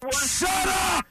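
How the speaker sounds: high, slow, drawn-out mock shout; hammed-up shout at whiny fans; shouted shut up. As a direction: blast it like a drop-in sound bite. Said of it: "have that one handy anytime".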